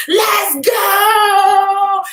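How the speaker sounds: high, loud hyped shout; retold scream. Retelling her morning goal ritual, hyped shout. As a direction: scream the goal out like a rally cry. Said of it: "And I'm screaming".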